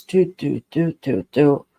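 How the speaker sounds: even, sure beats, each one the same; steady confident dog response. Shown as a demonstration. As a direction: mark it like a dog that knows. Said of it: "this is it".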